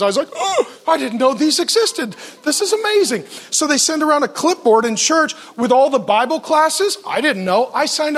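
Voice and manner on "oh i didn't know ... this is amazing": high, laughing gush of delight; thrilled. Retold or imitated. New convert to his church, overjoyed. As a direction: gush like a kid who just found treasure.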